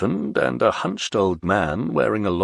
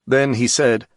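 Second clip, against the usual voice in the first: ordinary delivery, nothing marked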